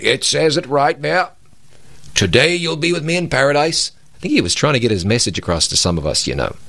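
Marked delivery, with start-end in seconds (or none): 0.0-3.9 imitating an insistent preacher, pitched higher; emphatic preacher. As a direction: drive the point home like a preacher. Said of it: "He was emphatic".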